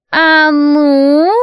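put-on high, sharp old woman demand; old hag. A narrator's reading. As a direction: bark it like a bossy crone.

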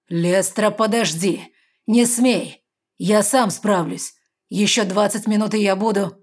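loud, pleading shout; urgent plea. A narrator's reading. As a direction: beg her to stop. Tiger pleads to stop her.

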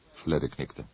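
ordinary delivery, nothing marked

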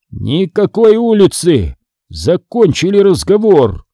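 slow, high-pitched firm order; stern zombie father. A narrator's reading. Father ends the argument with son, stern slow zombie drawl. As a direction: drag out each word to shut the argument down.

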